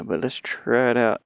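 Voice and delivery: usual speaking voice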